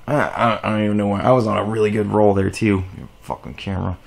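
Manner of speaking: low, soft, deflated muttering; dejected mutter under the breath; grumbling to self. Real, not acted. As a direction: trail down into a quiet grumble. Grumbling to self over lost roll.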